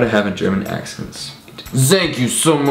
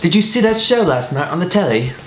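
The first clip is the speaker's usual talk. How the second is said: higher, chatty posh British; posh British accent; 5 semitones higher than usual. Pretend. Friend asks about last night's telly, posh British accent. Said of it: "British posh".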